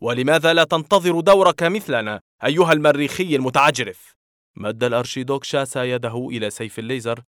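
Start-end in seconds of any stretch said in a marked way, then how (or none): 0.0-4.1 louder, higher indignant retort; heated challenge; angry shout. A narrator's reading. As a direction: snap back at the arrogant Martian. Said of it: "صائحا".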